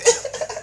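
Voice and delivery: high, airy burst of laughter; amused laugh. Real, not acted. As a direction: crack up in a high voice. Cracking up at crazy boy.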